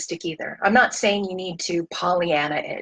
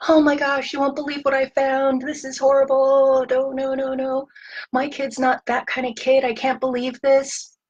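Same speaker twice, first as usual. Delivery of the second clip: higher, imitating a parent venting to a partner; mock venting; 5 semitones higher than usual. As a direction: let off steam in a lightly upset rush. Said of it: "to blow off the steam".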